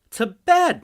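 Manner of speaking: high, loud sharp command; scolding mother. Acted. Mother scolding her daughter to bed, loud and sharp. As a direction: snap the child off to bed. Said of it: "my wife scolds her".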